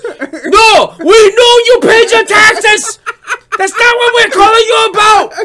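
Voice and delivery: high, loud mock yell at the caller; retold yelling. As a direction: shout it back like the angry scammer. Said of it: "this dude started yelling at me".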